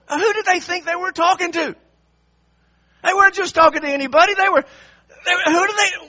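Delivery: loud, high-pitched affronted outburst; mock indignation. Preacher voicing offence at priests confronting a king, loudly indignant. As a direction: act outraged at being challenged.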